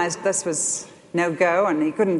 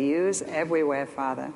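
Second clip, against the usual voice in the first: softer, sinking to a hushed Father; reverent, quiet prayer; 5 semitones lower, 5 dB quieter than usual. Shown as a demonstration. Child of God looking up to heaven, hushed and reverent. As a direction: say it up to heaven, in awe. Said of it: "you can look to heaven and say".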